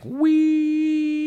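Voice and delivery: very high thin imitated ringing; high ringing whine. Shown as a demonstration. Describing his migraine ringing to listeners, very high imitated whine. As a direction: squeal it like the ringing in his ears. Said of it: "like, on the high".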